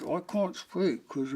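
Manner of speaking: slow, muffled speech through clenched teeth; jaw wired shut. Retold or imitated. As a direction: imitate talking with the jaw locked, trailing into mumble. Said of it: "his jaw was wired shut".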